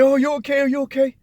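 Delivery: high, fretful mother's voice, imitated; anxious mother. Son imitating his anxious mother, high fretful voice. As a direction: imitate a worried mom fussing. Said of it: "my mother to come in that room and say".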